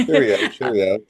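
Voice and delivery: laughing, hammed-up British cheerio; mock British. Host answering co-host's praise, laughing mock British. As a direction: play up a silly British accent through a laugh. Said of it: "How's my British accent?".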